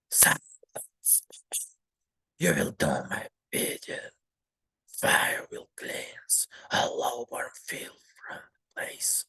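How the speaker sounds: slow, rasping whisper of a guilt-mad grandfather; sinister old man whisper. Acted. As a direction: hiss out your sins like a man losing his mind. Grandfather's menacing whispered vow.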